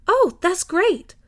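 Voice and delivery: high, slow, gushing exclamation; delighted Millie. A narrator's reading. Millie meeting the new neighbour, gushing high. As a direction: stretch it out with delight.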